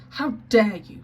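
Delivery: a furious how dare you; angry cry. Acted. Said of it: "she cried out".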